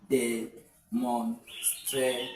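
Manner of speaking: very slow "Demonstrative" in separated syllables; drawn-out teacher breakdown of "Demonstrative" with pauses. Shown as a demonstration. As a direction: break "Demonstrative" into de, mon, stra, tive. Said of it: "Can I get another one".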